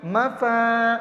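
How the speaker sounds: ما flowing into a long-held فا; gentle, without jerk. Shown as a demonstration. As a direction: ease into فا and stretch it slowly. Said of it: "آرام سے بولتے ہیں ہم". (ما فا آرام سے، کھینچ کر)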